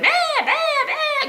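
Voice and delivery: high, loud goat bleating; imitated frantic goat bleats. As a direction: bleat like panicking goats. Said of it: "like they're freaking out".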